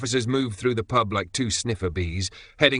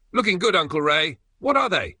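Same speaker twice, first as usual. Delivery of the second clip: bright, high and louder call-out; cheery call; 9 semitones higher, 4 dB louder than usual. Acted. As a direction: call out cheerfully to your uncle. David calls out to Raymond.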